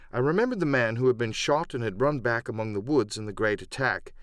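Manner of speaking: usual speaking voice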